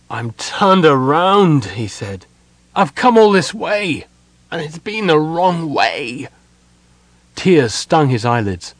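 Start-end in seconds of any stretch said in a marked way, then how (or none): 0.1-1.6 high, loud, drawn-out despair; bitter despair. A narrator's reading. Sylvan realises he went astray, in bitter despair. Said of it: "and with it bitter despair". 2.8-6.3 higher, anguished, slowing at the end; bitter despair. A narrator's reading. Sylvan realises his long trek was wasted, despairing. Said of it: "and with it bitter despair".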